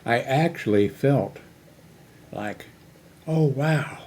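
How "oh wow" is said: ordinary talk, nothing marked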